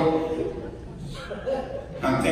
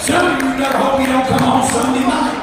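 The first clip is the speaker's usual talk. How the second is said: loud, higher preacher's shout; preaching shout; 7 semitones higher, 4 dB louder than usual. Real, not acted. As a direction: call it out to the back pews. Preacher shouting a joke at the crowd.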